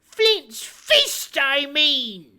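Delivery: high, loud, exasperated correction; impatient cry. Acted. Blind beggar correcting the man at the window, angry cry. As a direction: snap back the correction. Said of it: "he cried".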